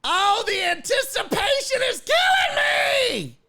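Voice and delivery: high, hammed-up drunk woman's shriek; drunk lady's wail. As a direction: shriek it like a tipsy fan hiding under a towel. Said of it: "covered her own eyes and went".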